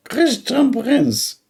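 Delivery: very high, drawn-out name; mocking high voice. Repeating how the caller said his name, high and slow. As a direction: squeak the name out slowly. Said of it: "such a voice, I never heard".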